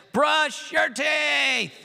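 high, slow, loud exasperated shout; tired mother shouting the kids upstairs; wife's shout. Retold or imitated. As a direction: yell it like a fed-up mom at her kids. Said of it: "I can hear my wife after hearing it say".

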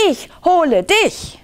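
high, punchy storybook cry; child's defiant yell. A narrator's reading. Lieschen threatens the Lämmergeier back, high defiant yell. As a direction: turn the threat round in a high voice. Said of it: "schrie Lieschen Radieschen".

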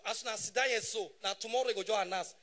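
usual speaking voice

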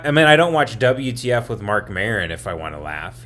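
ordinary delivery

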